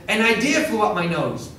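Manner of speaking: put-on high, loud and giggly child; little girl voice. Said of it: "one little girl said".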